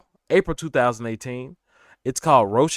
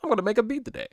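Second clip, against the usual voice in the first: high, eager little announcement; excited self-quote; 12 semitones higher, much faster than usual. Retold or imitated. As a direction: chirp it out much higher, keen to start. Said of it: "it was probably like".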